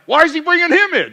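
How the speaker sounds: high, loud exasperated yell at the TV; mock angry fan. Venting to Karen, shouting at the manager. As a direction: vent like a frustrated fan. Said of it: "and I'm like".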